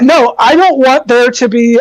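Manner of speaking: ordinary talk, nothing marked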